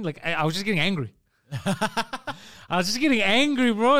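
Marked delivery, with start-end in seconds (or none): 1.5-4.0 higher, drawn-out words through laughter; laughing. Real, not acted. Podcast guest confessing his anger to his friend, laughing. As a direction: laugh while admitting it, stretching it out. Teller cracks up at own anger.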